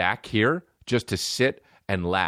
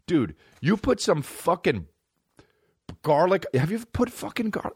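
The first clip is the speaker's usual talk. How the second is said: excited; 8 semitones higher than usual. Real, not acted. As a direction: get fired up about broccoli. Hyped rant about broccoli.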